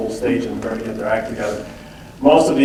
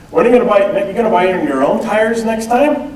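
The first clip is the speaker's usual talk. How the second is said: higher, mock-nagging father to his grown daughter; teasing dad; 7 semitones higher than usual. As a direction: needle her about paying her own way. Dad teasing daughter about tires.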